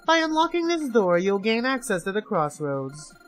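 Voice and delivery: gloating captor over a speaker; mocking villain host. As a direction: sneer at them like a game master. Villain announcer addresses players.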